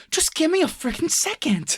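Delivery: very high, strained near-crying plea; imitating Mark Wahlberg's high teary plea; mock tearful whine. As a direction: squeak it out close to tears. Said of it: "Mark Wahlberg's response is just".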